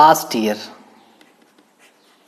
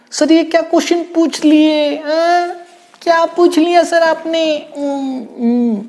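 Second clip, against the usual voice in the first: very high put-on student voice; mock student protest, high-pitched; baffled student; 12 semitones higher, 3 dB louder than usual. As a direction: squeal the question like a stumped pupil. Acts out a baffled student's question.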